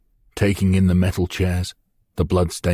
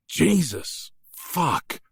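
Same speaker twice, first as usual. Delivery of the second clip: pained outcry; 10 semitones higher, much slower than usual. A narrator's reading. Hero struck from behind.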